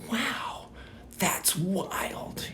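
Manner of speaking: high, soft wow of wonder; high-pitched, quiet marvelling; amazed reaction. Retold or imitated. Retells amazed reaction to word.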